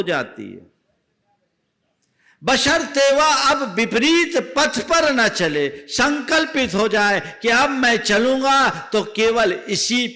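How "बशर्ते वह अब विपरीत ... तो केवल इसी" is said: higher and louder, preaching at full force; a preacher rousing his listeners with a vow; fervent preaching. Acted. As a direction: proclaim the vow to the crowd. Preacher urges resolve.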